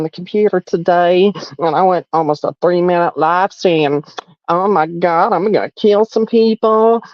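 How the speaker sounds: congested mock-villain voice; hammed-up, stuffed-up goblin taunting; nasally goblin impression. Mock villain introducing himself and bragging to Daddy, nasally impression. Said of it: "do you think I got the nasally".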